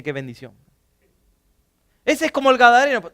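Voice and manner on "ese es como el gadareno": mocking complaint. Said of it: "le decía el gadareno a su esposo".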